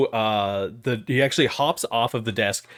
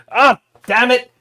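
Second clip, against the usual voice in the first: loud, high yelp of pain; 14 semitones higher, 9 dB louder than usual. Acted. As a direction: yell as he bangs his head.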